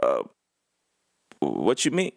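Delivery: halting, thrown-off mock reply; stall, then ask, mildly stunned; imitating shocked coach. Head coach stunned silent on the phone, imitated as mildly shocked. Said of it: "Like, he was shocked".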